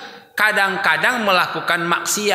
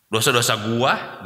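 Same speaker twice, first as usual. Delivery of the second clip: lower, defiant put-on voice; mock defiant sinner; 6 semitones lower than usual. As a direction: claim the sins as your own. (suara menantang yang dibuat-buat)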